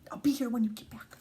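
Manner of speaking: quick hushed whisper. Real, not acted. Teacher whispering to the child sent off to tidy up. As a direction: whisper it fast like a secret promise.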